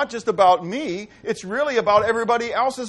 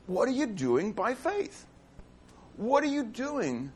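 softer, slower question; 6 dB quieter, slower than usual. Real, not acted. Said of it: "I'm not trying to yell at you".

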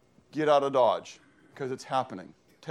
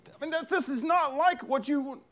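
high-pitched, flustered objection; sputtering protest; 13 semitones higher than usual. Retold or imitated. Prophet objecting to what God is doing, flustered disbelief.

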